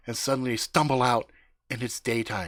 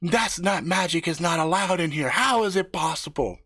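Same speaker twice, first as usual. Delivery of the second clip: mild mock scream; 7 semitones higher, 3 dB louder than usual. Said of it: "The things scream out".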